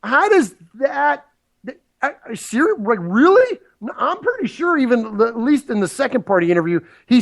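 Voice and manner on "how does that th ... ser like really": incredulous. Real, not acted. Host stunned by Wingo's excuse, sputtering incredulously. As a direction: pitch jumps high in disbelief, words stumbling.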